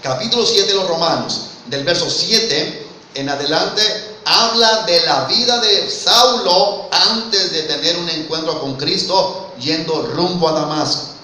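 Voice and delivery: ordinary talk, nothing marked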